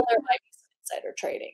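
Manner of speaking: quick confidential whisper; conspiratorial aside. Pretend. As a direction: whisper it like a playful secret. Jokingly confiding after denying it.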